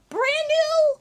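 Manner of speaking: thrilled squeal, very high; excited shout. Real, not acted. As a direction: hype it up loud. Hyped about sealed box.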